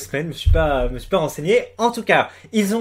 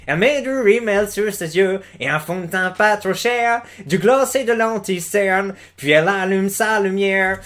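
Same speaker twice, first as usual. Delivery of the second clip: lent, chantonnant, à l'américaine; récite les paroles façon country, accent américain; 3 semitones higher than usual. Acted. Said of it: "Il parle comme ça avec l'accent américain". (accent américain traînant, plus aigu)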